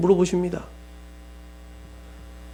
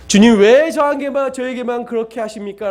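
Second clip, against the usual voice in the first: complaining to God; 6 semitones higher than usual. Retold or imitated. Believer complaining to the Lord, high pleading whine. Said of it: "라고 원망할 수 있습니까?".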